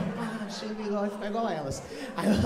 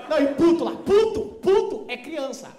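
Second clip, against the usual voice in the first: louder, higher insistence on puto; loud exclaim; 5 semitones higher, 9 dB louder than usual. Real, not acted. Explaining the slang mix-up, a loud high exclaim. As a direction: hammer the word puto loudly.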